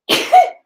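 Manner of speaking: sudden loud sneeze; a real sneeze bursts out; sneezing. Real, not acted. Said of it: "I'm gonna sneeze in a minute".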